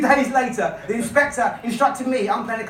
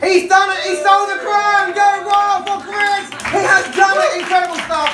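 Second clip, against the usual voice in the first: higher and louder, cheering on the crowd; excited hype; 5 semitones higher, 4 dB louder than usual. Real, not acted. Host rallies the crowd to cheer for Chris, elated and loud. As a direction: whip the audience into applause.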